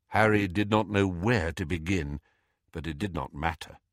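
usual speaking voice

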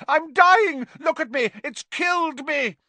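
very high, loud panicked yell; Malfoy's yell. A narrator's reading. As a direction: scream as if mortally wounded. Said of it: "Malfoy yelled".

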